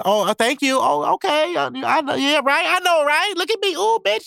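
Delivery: put-on very high, preening girly voice; vain girl. Conceited girl soaking up compliments, shrill and very high.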